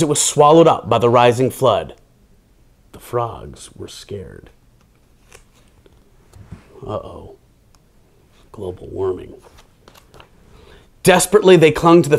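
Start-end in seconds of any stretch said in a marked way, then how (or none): 2.9-4.5 much lower, softer and slow; hushed spooky. A narrator's reading. 6.8-9.3 deadpan aside. Real, not acted.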